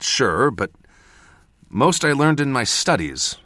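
high, pleading self-justification; agitated excuse, easing slightly toward the end; defensive. A narrator's reading. Mentor excusing inexperience to apprentice, defensive. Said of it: "I said defensively".

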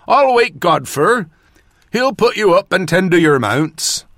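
high, loud rustic northern watchman; old watchman. A narrator's reading. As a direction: offer help as an old countryman. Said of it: "the watchman said".

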